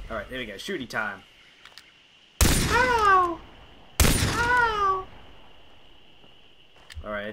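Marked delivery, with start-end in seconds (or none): 2.4-3.4 mock pain yelp. Character hit in game. 4.0-5.1 mock pain yelp. Character hit in game.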